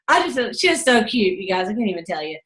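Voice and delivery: ordinary talk, nothing marked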